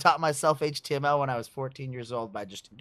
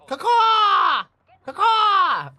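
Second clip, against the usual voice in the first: very high shouted caw; crow caw; 17 dB louder, much slower than usual. Acted. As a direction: screech like a crow. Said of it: "We're doing bird sounds?".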